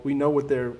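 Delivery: ordinary talk, nothing marked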